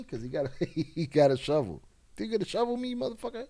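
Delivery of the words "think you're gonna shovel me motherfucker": higher-pitched mocking imitation of a yelling intruder. Commentator mocking the intruder's threat, high imitation. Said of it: "he tells the guy".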